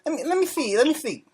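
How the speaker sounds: high put-on boxer voice; halting, high-pitched caller; Mike Tyson. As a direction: keep the voice up high and light. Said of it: "this is Mike Tyson".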